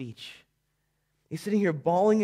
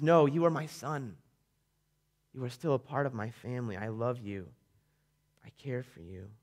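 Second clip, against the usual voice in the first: a hint of a tender father, fading softer; 6 semitones lower, 5 dB quieter than usual. Father telling his son he still belongs, softly fading. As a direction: reassure him gently, quieter each line.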